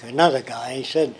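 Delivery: usual speaking voice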